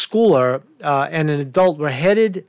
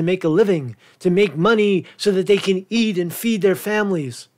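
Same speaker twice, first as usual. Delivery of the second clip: sarcastic higher-pitched mock sympathy, stretched "poor"; mock pity; 4 semitones higher than usual. Host mimicking those who smear the girl, mock pity. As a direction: lay on the fake pity, pitched up. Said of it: "you're trying to smear the girl and say".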